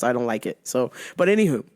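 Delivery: ordinary talk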